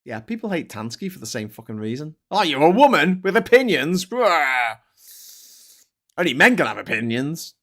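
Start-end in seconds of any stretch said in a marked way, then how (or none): 2.3-7.5 mocking. Mocking a sexist man.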